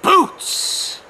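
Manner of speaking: plain reading voice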